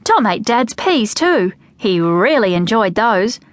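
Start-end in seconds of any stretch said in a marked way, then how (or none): none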